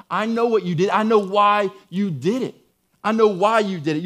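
insistent, seeing right through the lie; forceful confrontation. Acted. Preacher voices God confronting Sarah.

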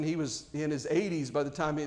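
ordinary talk

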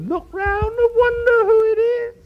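very high, loud, on one note; exaggerated octave-jumping prayer voice; mock praying voice. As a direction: hoist it up into a silly high monotone. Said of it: "their voice goes up six octaves".

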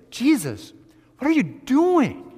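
high, loud, disbelieving Peter voice; astonished Peter. Retold or imitated. Preacher voicing Peter shocked at Jesus, high and loud. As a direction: Peter gasping at his master, alarmed. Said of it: "It's like Peter saying".

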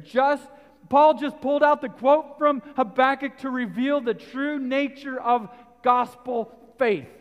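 loud, high preaching to the congregation; full-force preaching. Acted. Preacher pressing the point on faith, full force. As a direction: drive it home from the pulpit.

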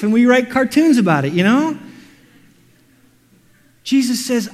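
ordinary talk, nothing marked

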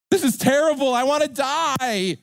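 hammed-up tantrum wail; despairing Jonah. Jonah wailing over his withered plant.

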